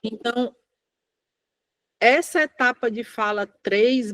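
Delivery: ordinary delivery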